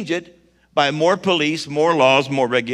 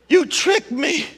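high indignant cry; 13 semitones higher, slower than usual. Acted. Wife realizes she was fooled.

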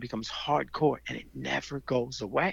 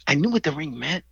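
usual speaking voice

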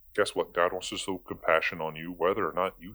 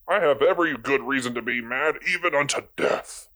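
dramatic Jonah; 5 semitones higher, 3 dB louder than usual. Retold or imitated. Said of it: "Jonah's answer is".

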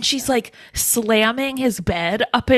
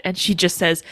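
ordinary delivery

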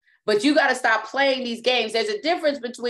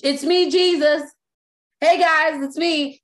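a breezy, louder hey-guys; casual mock greeting; 6 semitones higher, 3 dB louder than usual. Jesus as a casual buddy greeting the disciples, breezy. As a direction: play it offhand and chummy, a bit loud. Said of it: "What he didn't say is".

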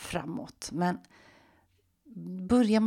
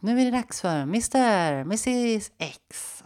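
mock stage announcer; 5 semitones higher, 5 dB louder, slower than usual. Pretend stage announcement to audience.

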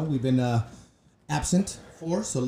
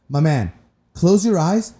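louder and higher, swaggering order; like a man past caring; Will's toast answer; 7 semitones higher, 6 dB louder than usual. Retold or imitated. Will telling the breakfast waiter to pick, loud and cocky.

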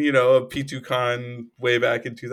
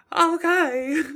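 high, squeaky mock-kid "Okay."; imitating co-host's kid voice; 8 dB louder than usual. Co-host copying the host's silly voice, high and squeaky. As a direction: echo the silly toddler voice. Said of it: "I do like the".

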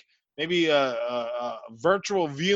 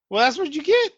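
voice cracking; 10 semitones higher, 3 dB louder than usual. Real, not acted. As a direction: voice jumps up and cracks. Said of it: "I don't know why my voice went like that".

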